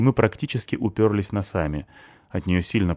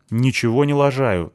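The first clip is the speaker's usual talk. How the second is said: offended; 6 semitones higher, 4 dB louder than usual. A narrator's reading. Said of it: "обижается он".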